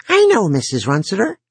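put-on bright, lonely young boy; eager teen boy voice. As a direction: talk like a lonely chatty kid. Jory's voice in the ear.

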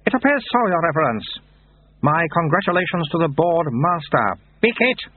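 high, formal reply turning to a sudden call; high-voiced monastery figure. Monk and baby abbot in council, high-voiced. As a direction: congratulate formally, then cry out in infant demand.

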